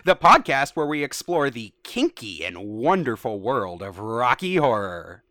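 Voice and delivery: loud, hammy host opener; over-the-top podcast host welcome; cheesy radio host. Acted. Reading AI script as host.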